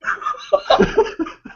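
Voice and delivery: high unvoiced burst of laughter; real airy laugh at the joke; high breathless laugh; breathy high laugh. Real, not acted. Reacts to cohost's joke.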